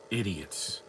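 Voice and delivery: normal talking voice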